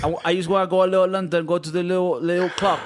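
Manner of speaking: high-pitched mock London accent. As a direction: play a tourist-cliché Londoner. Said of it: "say it with an accent".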